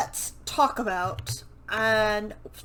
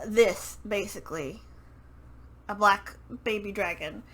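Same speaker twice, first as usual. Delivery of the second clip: normal talking voice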